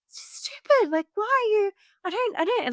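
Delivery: high, exasperated mock rant; retelling her own frustration, pitched high; frustrated. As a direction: sputter in disbelief. Retold frustrated inner rant.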